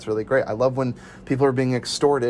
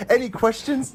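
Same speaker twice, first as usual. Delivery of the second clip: much higher cheery question; mock presenter; 11 semitones higher than usual. Retelling the presentation scene, high chirpy question. Mimicking character.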